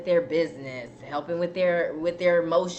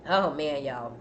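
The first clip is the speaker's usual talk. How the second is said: ordinary talk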